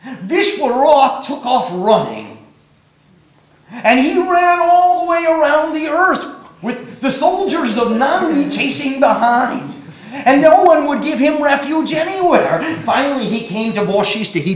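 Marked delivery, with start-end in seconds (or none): none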